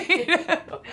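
high laughing you know; laughing at the memory. Real, not acted. Laugh after the retold question.

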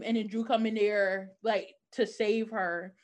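ordinary delivery

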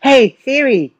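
high-pitched summons to a voice assistant; calling Siri. Real, not acted. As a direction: call out to wake the phone.

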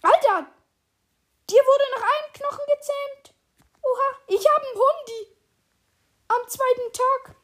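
high, loud, thrilled outburst; real excitement. Real, not acted. Player has just tamed a dog, thrilled and amazed. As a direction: crow over it in disbelief.

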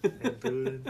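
put-on giggling drunk greeting; laughing imitation. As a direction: laugh through it. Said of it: "drunk Kyle Beal".

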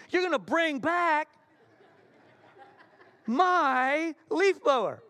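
"my" drawn out long and loud; emphatic MY. Acted. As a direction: hold and punch "my" before "leaf blower". Acted demand, MY drawn out.

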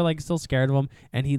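ordinary delivery

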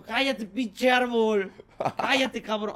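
put-on slow, loud drunk yelling at a tree; town drunk. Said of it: "el L S D no habla como borracho".

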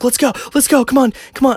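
high, loud imitated call; urgent hurrying. Man voices friend calling mother to the car, urgent yell. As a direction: mimic a gal pal urgently yelling to hurry.

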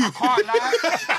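slow, high laughing words. Real, not acted. Friends recall a painful match, laughing.

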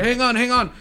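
high, loud quoted call; voiced grandfather. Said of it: "we just hear".

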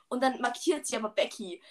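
ordinary talk, nothing marked

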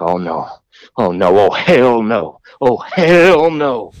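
drawn-out "hell", mock-horrified; retold dismay. Man backing off from the wasted, costumed storyteller, mock-terrified. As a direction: imitate a drunk guy's slow, dawning dread. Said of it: "he looked terrified of me".

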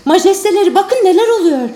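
high, loud amazed shout; excited cry. Acted. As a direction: cry out in wonder. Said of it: "diye haykırdı Gonzalo".

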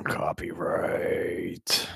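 breathy, slow, anguished whisper; despairing whisper. Real, not acted. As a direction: whisper it like a lament. GM grieves losing music features.

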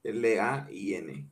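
slow, letter by letter; over enunciating. Shown as a demonstration. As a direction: spell each letter out clearly. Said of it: "yo lo deletreo". (deletreando despacio, letra por letra)

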